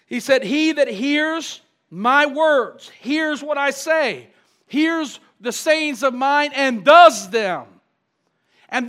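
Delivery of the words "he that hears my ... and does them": slow, stretched-out emphatic preaching; emphatic scripture quoting. Real, not acted. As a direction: draw out each key word for the congregation.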